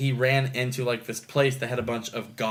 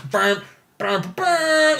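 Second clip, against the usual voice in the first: rising brassy "burn" noises; imitated fanfare; 13 semitones higher, 4 dB louder, much slower than usual. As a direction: voice a giant-robot theme.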